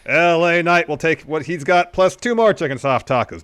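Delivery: bully the cashier as the cocky champ; L A Knight. Acted. Ordering at Del Taco, loud brash L A Knight impression. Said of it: "L A Knight's gonna take the lead".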